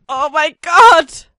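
loud, drawn-out "oh my god"; real shock; shocked exclamation. Real, not acted. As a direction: cry out in disgust. Disgusted at where he jumps.

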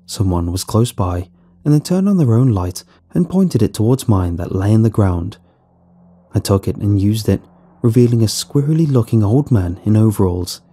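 usual speaking voice

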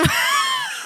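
high shrieking laugh; burst of laughter. Real, not acted. Partner collapsing at the failed accent, shrieking laugh. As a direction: crack up at your own attempt.

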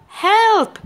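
second high, loud cry for help; shouting for help. A narrator's reading. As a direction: call out again, high and loud. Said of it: "Cat was shouting".

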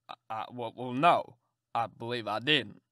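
put-on low, stammering old man; an old man caught off guard; flustered old gentleman. Old gentleman asked if she liked it, low and stammering.

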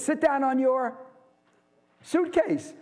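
lightly voicing the old dairyman; Tevye. Lecturer quoting Tevye to his class, lightly voiced.

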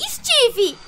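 normal talking voice

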